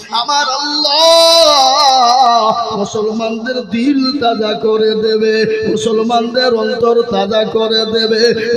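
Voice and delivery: slow, loud stretched sermon line; slow chanting proclamation; drawn-out preaching. Acted. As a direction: draw every word out for the faithful. Preacher stretches promise slowly.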